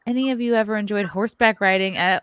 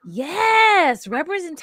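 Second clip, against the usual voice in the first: high cheering yes; excited cheer; 9 semitones higher, 5 dB louder than usual. Real, not acted.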